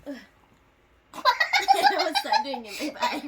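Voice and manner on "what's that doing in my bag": slow, giggling question; laughing. Pretend. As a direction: ask it while cracking up. Silly bit, laughing.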